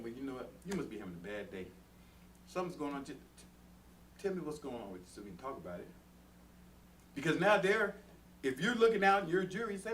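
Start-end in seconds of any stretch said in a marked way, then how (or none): 0.0-0.5 low, soft, conciliatory voice; acting out a softer response; calm, understanding reply. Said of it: "Instead of saying". 0.6-5.9 low, soft, conciliatory voice; acting out a softer response; calm, understanding reply. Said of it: "Instead of saying".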